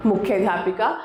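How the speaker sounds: plain reading voice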